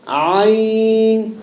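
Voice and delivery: Ain. from the middle of the throat, constricted; Arabic letter ain. Shown as a demonstration. Teacher demonstrating the throat letter, constricted. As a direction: squeeze Ain. from mid-throat. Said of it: "Not ain, not ain".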